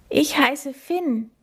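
slow, high, weak croak. A narrator's reading. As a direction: squeeze the name out, slowly and thinly. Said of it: "krächzt Finn". (langsam und hoch gekrächzt)

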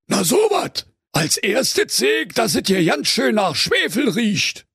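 high-pitched Berlin detective; sleuthing commissioner. A narrator's reading. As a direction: report your finding with high excitement.

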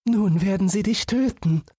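put-on understated weeping girl's voice; lightly tearful sister. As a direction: lament being left alone, mildly. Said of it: "Das Schwesterchen weinte und sprach".

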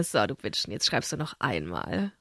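low, quiet gloating. Retold or imitated. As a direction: savor the revenge under breath. Gloating thought after revenge.

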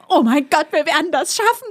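high, loud panicky pep talk; shouted reassurance. Retold or imitated. Cutter shouts at her to calm her, high yell. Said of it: "schrie er mich an".

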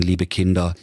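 ordinary delivery

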